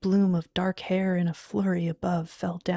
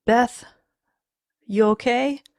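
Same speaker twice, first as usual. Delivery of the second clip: loud, higher question; anxious, raised voice; worried calling; 5 semitones higher, 8 dB louder than usual. Acted. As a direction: call out to check on her. Alarmed, calling to wife.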